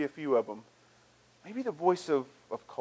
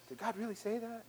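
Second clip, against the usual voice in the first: higher, softer sceptical question; insinuating doubt; 5 semitones higher, 10 dB quieter than usual. Acted. Tradition doubting God's call to create, softly sceptical. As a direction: quietly question it in disbelief. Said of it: "It's that voice that says".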